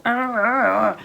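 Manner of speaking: sudden loud, high pained cry; body crying out. Pretend. The body protesting exercise, sharp loud pained cry. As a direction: yelp as if the body is hurting. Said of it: "your body will go".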